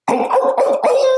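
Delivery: very high, loud howling; wolf howl. Acted. Wolf howls in woods.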